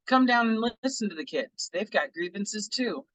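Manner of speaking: normal talking voice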